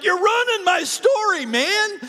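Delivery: high, loud whiny protest; exasperated complaint. Retold or imitated. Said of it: "I'm going like".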